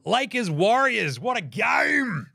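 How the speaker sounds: big high, drawn-out announcer shout; excited hype. Real, not acted. Host announcing a marquee matchup, excited hype. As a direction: stretch out each word and call it high.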